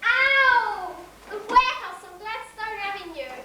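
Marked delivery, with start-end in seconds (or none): 0.0-0.9 sharp, higher yelp of pain; cry of pain. Acted. Girl yelps as her arm is twisted, pained cry.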